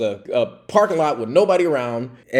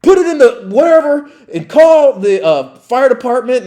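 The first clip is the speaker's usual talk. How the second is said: loud, high-pitched supervisor's shout; yelled order; 10 semitones higher, 10 dB louder than usual. Retold or imitated. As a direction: bellow orders like a boss freaking out. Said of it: "He's yelling at me".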